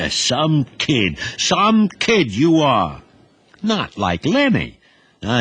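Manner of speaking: an uncle listing his nephew's misdeeds; a hint of an irritated older boss lecturing; Uncle Benji, exasperated.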